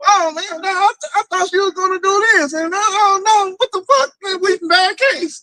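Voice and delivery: high, whiny voters regretting their vote; regretful Kamala voters. Retold or imitated. Voters begging their husbands to take them back, whiny and high. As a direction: wail it like sorry voters begging to come back. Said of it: "They was like".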